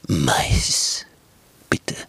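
low, whispered, sneaky wolf; the wolf ordering corn bread, low and whispered; sly wolf. Acted. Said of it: "scheel, diebisch und hinterlistig, wölfisch".

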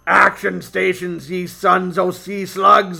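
slow gruff pirate captain, rising to a loud roar; hammy cyborg pirate barking orders; pirate robot voice. Acted. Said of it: "give the Captain the most piratey robot cyborg voice you can".

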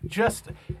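ordinary delivery

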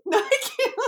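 loud high laughing. Real, not acted. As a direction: give up with a laugh. Giving up laughing.

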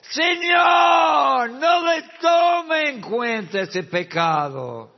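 Stephen's dying plea, cried out very loud and high; loud cry. Acted. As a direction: cry out to heaven with everything. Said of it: "clamó a gran voz. Mira, gritó".

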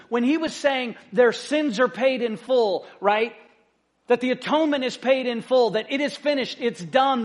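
fervent preaching swell; preaching at full force. Acted. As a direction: drive the point home to the congregation.

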